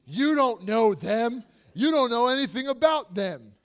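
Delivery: loud, slow, offended protest; indignant shouting. Acted. Dater defending a partner to friends, indignant shout. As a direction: shout down the friend's advice in mock outrage. Acted quarrel over dating advice.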